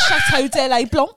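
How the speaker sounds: loud, laughing fake French; mock French tone. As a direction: belt out bad French with a grand accent. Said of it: "little French tone".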